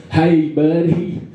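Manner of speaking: hammed-up Texan, stretched out and rising high; Texan accent. Friendly Texan offers help with a bull, Texan accent. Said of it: "this nice guy came up to me and he said".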